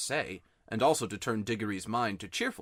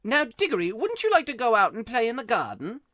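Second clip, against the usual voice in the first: lightly voiced fussing aunt, a touch louder; Aunt Letty; 11 semitones higher, 6 dB louder than usual. Diggory imitates Aunt Letty hushing his uncle, lightly voiced. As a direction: mimic the aunt shushing. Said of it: "She says".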